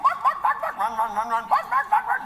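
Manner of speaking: high, loud yapping bark; barking dog. Pretend. Comic imitating the hyper dog, frantic high yaps. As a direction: yap it out like a crazed terrier. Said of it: "He's like".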